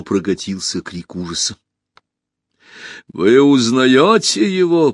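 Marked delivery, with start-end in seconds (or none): 3.1-4.9 louder, slow, raised declamation; empress addressing hall. A narrator's reading. Empress unmasking her son before the hall, declaiming. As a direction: put the question loudly to the whole hall. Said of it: "продолжала императрица".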